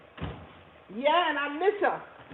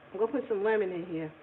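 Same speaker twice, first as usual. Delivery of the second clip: ordinary delivery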